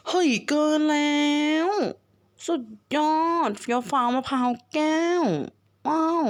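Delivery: thrilled exclaim. Real, not acted. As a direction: cheer it out, over the moon. Thrilled at an upgrade.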